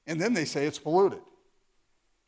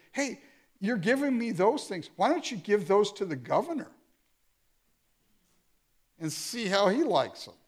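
higher-pitched, pointed sarcasm; sarcastic but pointed; 5 semitones higher than usual. Acted. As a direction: needle them with a sarcastic suggestion. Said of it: "sarcastically, but pointedly".

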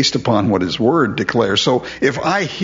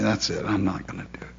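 much softer, fading resolve; soft resignation; 5 semitones lower, 10 dB quieter, faster than usual. Retold or imitated. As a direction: say it quietly, letting go. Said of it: "give it up and say".

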